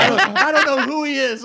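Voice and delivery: high, giggling imitation of the woman; laughing mock girl voice. Storyteller laughing as he mimics the drunk woman, high. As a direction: squeak it out while cracking up.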